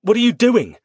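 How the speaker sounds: loud, high, shocked demand; alarmed demand. A narrator's reading. Man demanding why she attacked him, loud and alarmed. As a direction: throw the question at her in alarm.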